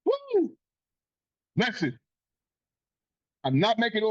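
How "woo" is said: high-pitched frustrated woo; sharp high exclamation of disbelief; exasperated whoop. Real, not acted. Venting about the loss.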